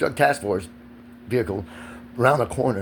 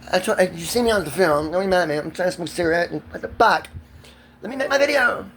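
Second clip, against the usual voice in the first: pleading outburst; 6 semitones higher than usual. Real, not acted. Pleading with partner to be left alone.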